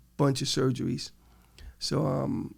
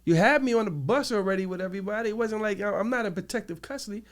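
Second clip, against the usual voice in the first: high, exasperated re-enacted argument; indignant protest; 7 semitones higher than usual. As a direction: argue back in disbelief, pitch raised throughout. Re-enacts arguing with the officers.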